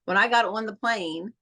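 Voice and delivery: ordinary delivery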